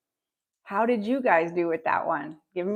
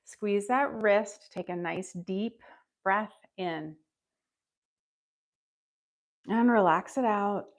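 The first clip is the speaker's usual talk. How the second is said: slow, calm breathing instructions; guided breathing; slower than usual. Acted. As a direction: talk them through a long exhale.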